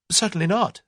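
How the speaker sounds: high, loud indignant retort; sharp indignant denial; with warmth. Acted. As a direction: fire it back with heat. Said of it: "said Tuppence with warmth".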